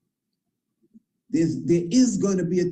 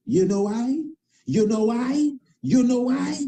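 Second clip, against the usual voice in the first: building question; 6 semitones higher than usual. Acted. Repeated rising rhetorical question.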